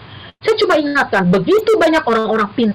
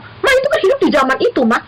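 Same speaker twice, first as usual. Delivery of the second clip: put-on high, whiny child's objection; arguing child; 10 semitones higher than usual. Daughter arguing with her mother, high whiny child voice. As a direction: whine back that those were different times. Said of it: "Lalu dia bilang".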